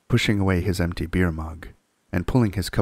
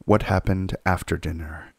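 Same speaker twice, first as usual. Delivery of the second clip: ordinary delivery, nothing marked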